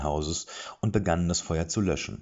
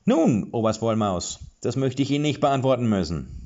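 formal dwarf; 6 semitones higher, 6 dB louder than usual. A narrator's reading. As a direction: deliver it stiffly and carefully. Dwarf addresses officer formally.

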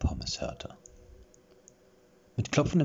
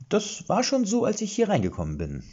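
nervous excuse; 6 dB louder than usual. A narrator's reading. Erik nervously excusing himself.

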